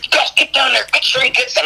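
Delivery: high, pushy bandmate sending him off; Pete's urgent order. Retold or imitated. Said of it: "He goes".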